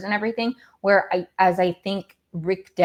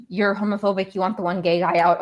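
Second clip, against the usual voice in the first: normal talking voice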